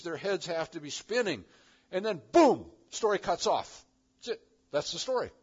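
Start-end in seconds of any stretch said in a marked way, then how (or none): none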